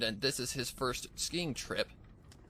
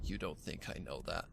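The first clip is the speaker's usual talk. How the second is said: low, much softer, almost breathless; barely above a whisper; 7 semitones lower, 6 dB quieter than usual. Acted. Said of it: "barely above a whisper".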